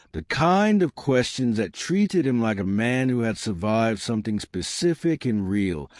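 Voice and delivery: ordinary delivery, nothing marked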